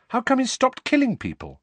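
high-pitched eager question; boyish, insistent query; puzzled boy. A narrator's reading. Harry asks, puzzled.

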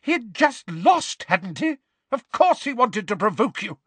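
high, exasperated tirade; outraged teacher at full steam; angry berating. Acted. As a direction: pour out indignant questions. Enraged professor berates the pupils.

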